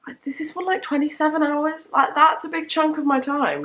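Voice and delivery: high, disbelieving; squeaky disbelief at the length; surprised. Real, not acted. Shocked at audiobook length.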